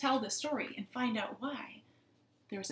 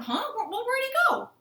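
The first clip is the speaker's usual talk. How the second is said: high, loud, stammered surprise; hammed-up startled search; bewildered frogs; 13 semitones higher, 9 dB louder than usual. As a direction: cry out in baffled frog voices. Frogs find the pig gone.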